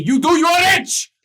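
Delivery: high, loud and insistent creditor; voice from below. Acted. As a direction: press him to stay and pay.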